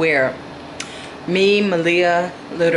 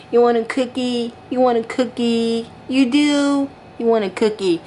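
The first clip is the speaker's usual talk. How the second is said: higher-pitched coaxing questions; doll baby talk; 5 semitones higher than usual. Pretend. Mother offering her doll a cookie, cooing baby talk. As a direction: coax a baby with a sweet offer.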